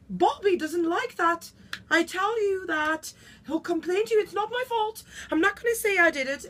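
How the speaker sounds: high-pitched protest of innocence; indignant warning. Acted. Warns that Bobby will complain, high and indignant. Warns others, disowns blame for noise.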